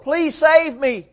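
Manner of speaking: high, slow plea for help; crying for help. Retold or imitated. Peter shriveled down, pleading to the Lord. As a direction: beg to be saved. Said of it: "crying for help".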